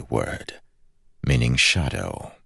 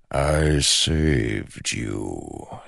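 slow ancient shadow voice; much slower than usual. Acted. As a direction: draw out every word like smoke. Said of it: "as if making a point".

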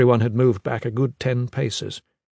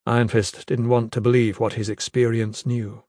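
plain reading voice

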